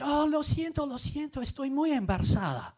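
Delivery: high, flustered apology in Spanish; mortified apology. Retold or imitated. Foreigner apologizing to host mother, high and flustered. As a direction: squeak out a panicked sorry. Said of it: "I said".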